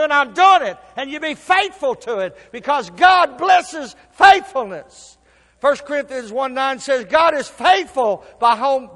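loud, high, slow shouted preaching; preaching at full force. Acted. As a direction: thunder it out slowly.

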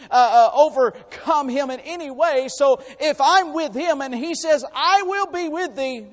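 from steady to loud proclamation; building preacher's declaration. Acted. Preacher assuring the church nobody can defeat God, building. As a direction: build the certainty up to a shout.

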